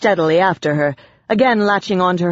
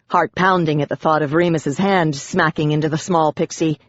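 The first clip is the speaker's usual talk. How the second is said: ordinary delivery, nothing marked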